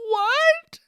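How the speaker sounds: very high-pitched mock disbelief; baffled kid. Kid whose insult was agreed with, baffled. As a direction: squeak it like a stunned child. Imitates a baffled kid's reply.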